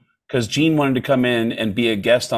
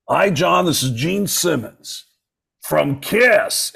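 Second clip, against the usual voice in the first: slow, higher mock [name]; [name] impression; 4 semitones higher, slower than usual. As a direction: draw out the name like Gene. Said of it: "And he hears".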